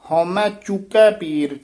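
ਚੁੱਕੈ said with a clear dulainkar ending, not ਚੁੱਕਾ; careful Gurbani recitation; correct Gurbani pronunciation. Shown as a demonstration. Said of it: "ਚੁੱਕੈ ਦ੍ਰਿੰਕੜ ਦੇ ਨਾਲ ਉਚਾਰਨ ਕਰਨਾ ਹੈ".

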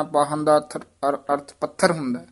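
usual speaking voice

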